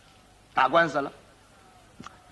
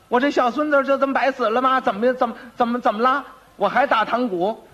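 high, aggrieved clamour; shouting old woman; 6 semitones higher than usual. Acted. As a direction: protest in a raised old granny voice. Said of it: "这个班房里头嚷啊".

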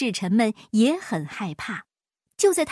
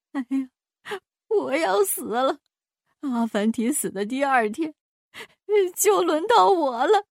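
terrified wail; 5 semitones higher than usual. Acted. King fears he will die.

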